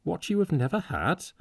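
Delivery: high, incredulous echo question; startled question, pitched well up; astonished. A narrator's reading. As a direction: repeat it back in disbelief. Arthur astonished by the retort.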